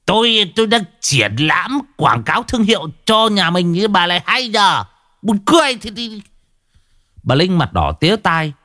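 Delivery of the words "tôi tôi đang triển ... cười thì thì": put-on higher, defensive old man's retort; a lightly voiced old man bickering; arguing back. Husband arguing back with his clawing wife, higher defensive voice. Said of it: "mà cãi".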